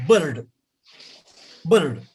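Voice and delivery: BIRD short with a sounded R; local pronunciation. Shown as a demonstration. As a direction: say BIRD as a quick "burrd". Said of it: "We say".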